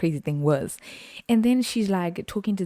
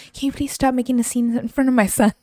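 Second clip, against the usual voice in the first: higher put-on indignant voice; mock pleading mother; 7 semitones higher than usual. Customer scolding coworker before her son, mock indignant. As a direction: imitate an affronted mum, pitched up.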